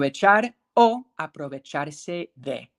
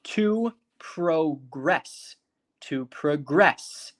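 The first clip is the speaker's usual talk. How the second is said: very slow "To progress", second syllable stressed; stress on GRESS; much slower than usual. Shown as a demonstration. Teacher shows the verb again, "progress" stressed on GRESS. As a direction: stretch out "progress", landing on GRESS. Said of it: "you hear the emphasis on gress".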